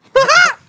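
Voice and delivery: high, loud burst of laughter; shrieking laugh. Real, not acted. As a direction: crack up loudly.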